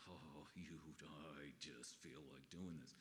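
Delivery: low, slow, soft grumble under the breath; sulky low aside, dropped way down and hushed; grumbling fleshly self. Retold or imitated. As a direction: mutter it like the worst version of yourself. Pastor voices his own fleshly impulse.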